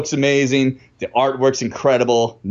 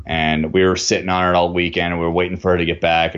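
ordinary talk

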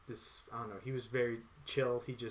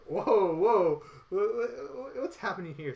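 shocked disbelief; 6 semitones higher, 5 dB louder than usual. Retold or imitated. Shocked at a huge sweat puddle.

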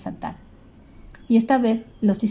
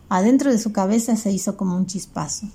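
ordinary delivery, nothing marked

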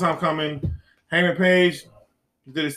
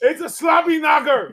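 high, slow catchphrase shout; wrestling commentator; 11 semitones higher, slower than usual. Pretend. Mimics Jim Ross's catchphrase.

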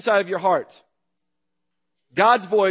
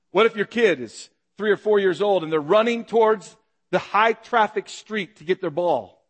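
normal talking voice

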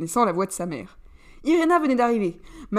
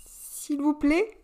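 drawn-out, higher plea; slow pleading; 6 semitones higher, much slower than usual. A narrator's reading. Cali stepping between father and son, slow plea. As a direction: beg them both to stop, slowly.

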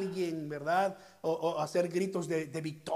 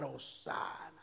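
ordinary talk

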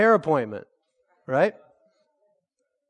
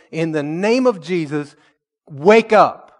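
commanding prayer shout; 7 dB louder than usual. Retold or imitated. Pastor over the phone commanding the woman to wake, loud command. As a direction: call her back to life with authority.

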